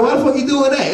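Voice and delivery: high-pitched mock bafflement; baffled. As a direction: question it in high comic disbelief. Retold baffled reaction to film.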